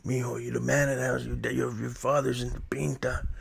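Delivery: imitating an uncle's low, quiet talk; hushed so no one else hears; real low voice. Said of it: "they talk real low".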